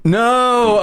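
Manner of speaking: long, high protesting cry; drawn-out, high-pitched refusal; mock horror. Real, not acted. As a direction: wail it in playful dismay. Mock horror at Corgi idea.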